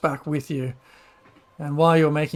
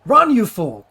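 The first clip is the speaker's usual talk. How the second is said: loud, higher urgent command; urgent Pops shout; 6 semitones higher, 9 dB louder than usual. Acted. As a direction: shout it as a warning to a runner. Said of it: "turns around and starts to say".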